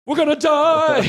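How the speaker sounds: hammed-up high, loud cry of doom; mock panic. Comic recalling anxiety attacks, mock panic. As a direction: wail it like a comic catastrophe. Panicked thought versus calmly naming feelings.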